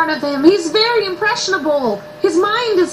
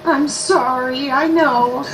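slow, lowered, soothing sympathy; tender comforting; 5 semitones lower, slower than usual. Acted. Mother comforting her spanked son, tender. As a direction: soothe a hurt child slowly.

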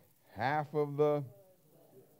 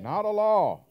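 emphatic declaration; 9 semitones higher, 9 dB louder, slower than usual. Real, not acted. Emphatic correction.

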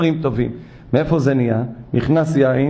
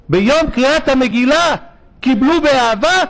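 higher, louder exalted preaching; rising fervour; 11 semitones higher, 4 dB louder than usual. Real, not acted. The rabbi stressing Purim love to the congregation, fervent and higher. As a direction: lift it up with passion.

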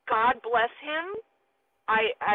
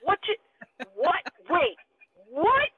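repeated stunned whats; 3 semitones higher, much slower than usual. Retold or imitated. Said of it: "we're like".

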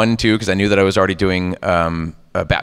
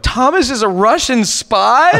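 high, loud, stretched incredulous question; hammed-up disbelief, voice shooting up; shocked disbelief; 5 dB louder, slower than usual. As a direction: read it out in mock astonishment. Retold shock reading the script.

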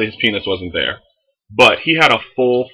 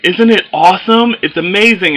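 high, louder, gushing baker; chipper; 10 semitones higher, 5 dB louder than usual. Retold or imitated. The baker shows off her cake, chipper and high. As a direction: gush like a proud baker. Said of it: "she's all, like, chipper".

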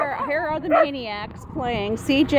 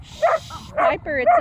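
soothing shush. Real, not acted. Calming her anxious dog.

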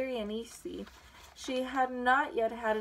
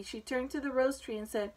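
usual speaking voice

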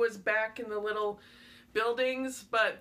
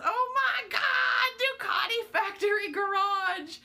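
excited shout; 8 semitones higher than usual. Retold or imitated. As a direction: shriek it like the group freaking out. Retelling group freaking out.

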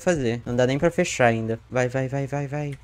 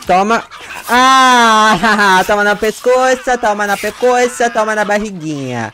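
loud high cackling gloat; gleeful laughing taunt; 6 semitones higher, 8 dB louder than usual. Real, not acted. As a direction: laugh while striking each blow. Laughing while killing survivor.

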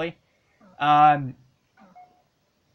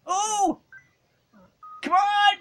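excited; much slower than usual. Real, not acted. Reacting to game.

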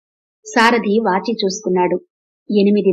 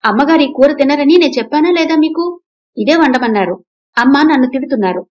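cook protesting angrily, pitched up; angry protest; 6 semitones higher, 5 dB louder than usual. A narrator's reading. Cook angrily defending himself to the old mother. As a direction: complain hotly about being blamed. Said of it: "వంటవాడు కోపంగా ఆమె వైపు చూస్తూ".

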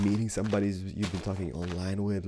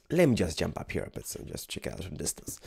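usual speaking voice

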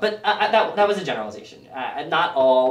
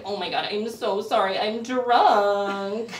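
put-on high, drunken party-girl apology; hammed-up tipsy pleading; 5 semitones higher, slower than usual. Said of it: "being drunk all the time".

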